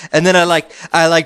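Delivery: normal talking voice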